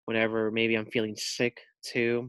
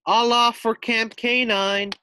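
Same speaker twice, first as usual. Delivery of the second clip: loud, high announcement; calling out; 10 semitones higher, 6 dB louder than usual. Acted. Bus driver announcing the stop, loud. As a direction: call all aboard down the bus.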